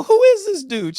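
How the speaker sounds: high, loud amazed question; hammed-up astonishment; astonished. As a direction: demand to know, totally amazed. Retells astonished question to seatmate.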